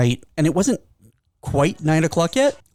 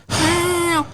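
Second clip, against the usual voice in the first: high, drawn-out cat yowl; demanding high-pitched meow; imitated cat meow; 13 semitones higher than usual. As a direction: yowl like a cat wanting food. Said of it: "do his, like".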